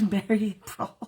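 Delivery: laughing through the words. Real, not acted.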